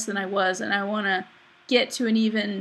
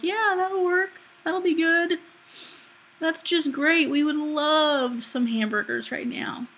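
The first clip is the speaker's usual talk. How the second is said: higher, eager gleeful voice; tempting inner voice; 6 semitones higher than usual. Retold or imitated. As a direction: play the sly little voice egging her on. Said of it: "there is a voice in there that's like".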